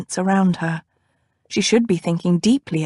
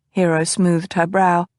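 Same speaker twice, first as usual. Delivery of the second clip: usual speaking voice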